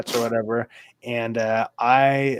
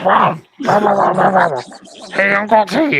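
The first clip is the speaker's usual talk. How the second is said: high, loud squawking cartoon duck; Donald Duck voice; 8 semitones higher, 8 dB louder than usual. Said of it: "That's a good impression".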